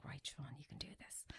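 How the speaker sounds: hushed self-encouragement under the breath; whispered pep talk. Retold or imitated. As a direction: whisper it to yourself to find courage. Psyching herself up before procedure.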